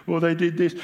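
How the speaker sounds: hammed-up excuse from a losing fan; whingeing Queenslander. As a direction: lightly send up a sore loser. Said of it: "Queenslanders, they lose".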